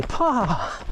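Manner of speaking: put-on high little-boy call of Pa; child calling Pa. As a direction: imitate a toddler calling for his dad. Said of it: "He'd say".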